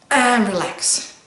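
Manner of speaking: slow, drawn-out relax; release. Shown as a demonstration. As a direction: let it all out slowly. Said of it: "Try it again".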